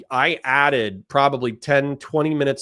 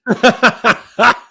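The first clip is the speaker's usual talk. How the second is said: loud burst of laughter; 11 semitones higher, 7 dB louder than usual. Real, not acted. Streamer laughs at clip.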